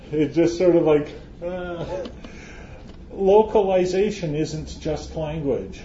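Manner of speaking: ordinary delivery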